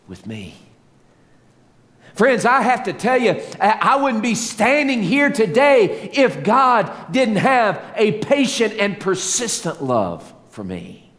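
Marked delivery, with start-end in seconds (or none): 2.1-11.1 high, loud, rising preacher's cry; full-force preaching. Acted. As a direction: preach it out to the whole church, driving each repeat.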